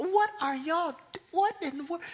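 high, loud startled outburst; startled cry. Retold or imitated. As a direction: shout up at the noise in the roof. Startled reaction to falling roof.

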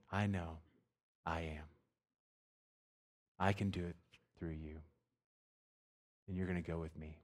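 soft, hushed voice of God answering; calm, softly spoken promise; gentle reassurance. Acted. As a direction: reassure quietly, with long pauses.

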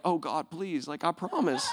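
small, soft pleading prayer; desperate prayer. Retold or imitated. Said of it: "my prayer is just like".

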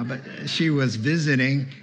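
ordinary delivery

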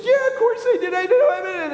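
put-on high little boy with a laugh in it; a kid laughing off the question. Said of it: "And the little boy says".

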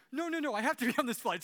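panicked backpedaling. Retold or imitated. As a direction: beg to stay on the plane, rushing. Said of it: "She starts backpedaling".

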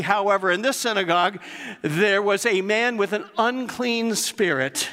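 preacher building fire before the congregation; full-force preaching. Acted. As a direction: drive it home from the pulpit.

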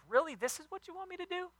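high, fast, incredulous whine; exasperated John. Retold or imitated. John the Baptist complains to God, high fast incredulous whine. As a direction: complain to heaven in disbelief. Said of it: "he's like".

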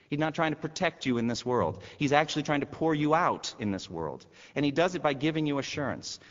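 preacher rising to a charge; impassioned preaching. Acted. Preaching builds in force.

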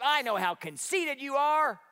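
"conceited" spat out high and loud, slow and sneering; mocking scorn. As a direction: sneer "I know how conceited you are" at him. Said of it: "He says".

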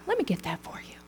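very soft acted offer; hushed helpful aside. Preacher playing someone spotting another's speck, hushed aside. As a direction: drop right down, as if discreetly helping.

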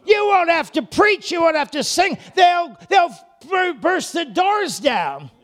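loud, high shouting preacher; thundering sermon climax; full-force preaching. Acted. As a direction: preach it out at the top of the voice.

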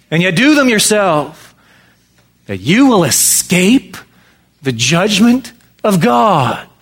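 fervent sermon delivery; preaching. Acted. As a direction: preach it out to the congregation. Continues the raised preaching.

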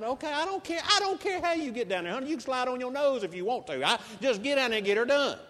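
egging her on, half laughing, getting it done; excited urging. Retold or imitated. Preacher hurrying the nurse to the patient, excited and high.

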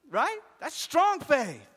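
high, shouted sermon delivery; full-force preaching. Real, not acted. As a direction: cry it out to the whole congregation.

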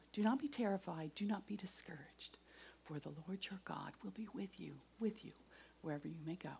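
scripture breathed in a hushed whisper; whispering Joshua one nine to the congregation; whispered verse. Acted. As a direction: whisper the promise like a secret comfort. Reciting her life preserver verse.

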